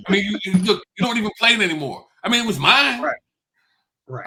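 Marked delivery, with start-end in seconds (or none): none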